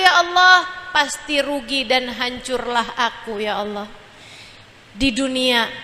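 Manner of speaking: swelling then fading plea to God; pleading prayer. Acted. Preacher prays for mercy, pleading then fading.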